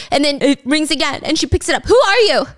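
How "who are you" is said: loud, high retold outburst; freaked-out demand. Woman picking up the phone a second time, freaked out. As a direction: throw it at the mystery caller, high and loud. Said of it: "she's looking freaked out".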